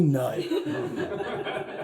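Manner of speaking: short breathy laugh, rising. Real, not acted. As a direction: laugh at the words. Reader laughs at the text.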